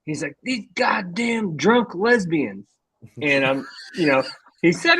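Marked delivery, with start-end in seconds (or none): 0.4-2.6 higher, drawn-out mock yell; slow, exasperated mock holler; imitated yelling old man. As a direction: put on an old angler's griping yell. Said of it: "I just keep hearing him yelling".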